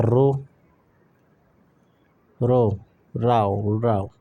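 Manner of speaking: row said rau, like cow; row rhymes with cow. Shown as a demonstration. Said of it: "rhymes with cow".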